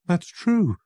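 high, loud, anxious; frightened. Acted. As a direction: answer in a nervous rush. Frightened visitor answers Holmes.

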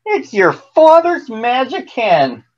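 high-pitched, amazed mother; wondering exclamation. A narrator's reading. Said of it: "his mother gasped with wonder".

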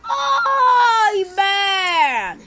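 loud, high cry of dismay; frustrated shout. Real, not acted. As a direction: belt out the groan at the screen. Reacting to bad gameplay.

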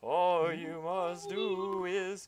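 high, slow, drawn-out ghostly wail; better ghost voice. Acted. Ghost delivering his prophecy to the player, high and slow. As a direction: stretch every word like a haunting spirit. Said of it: "I can do a better ghost voice".

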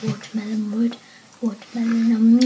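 words through chewing; chewing while talking. Real, not acted. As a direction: mouth partly full. Seen 2: Lips pushed forward in chewing shapes while tasting flavoured food.

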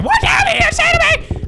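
furious falsetto shriek; fast, piercingly high offended screech; Jimmy. Acted. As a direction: explode in a loud squeaky character voice. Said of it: "My name is Jimmy".

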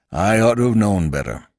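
a hint of gruff old landlord, contrite; gruff old innkeeper apologizing; lightly voiced rustic innkeeper. A narrator's reading. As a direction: admit it plainly, man to man.

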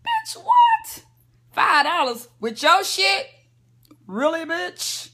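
mock outrage at the price; incredulous. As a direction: shriek it in outraged disbelief. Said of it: "I was like".

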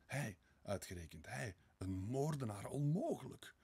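disbelieving, climbing to a high peak; shocked inner protest, rising; mounting disbelief. A narrator's reading. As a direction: build to an incredulous "impossible". Narrated disbelief of Sonja.

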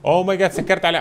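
sudden high, loud "oh my god"; alarmed gamer realizing his mistake; shocked cry. Real, not acted. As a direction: blurt it out in real shock. Locked customers inside by accident.

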